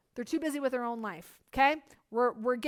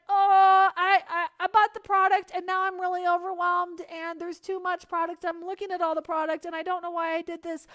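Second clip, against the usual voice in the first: mock overwhelmed buyer; 5 semitones higher, 6 dB louder than usual. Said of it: "someone's like".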